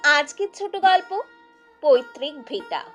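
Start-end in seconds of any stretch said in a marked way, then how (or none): none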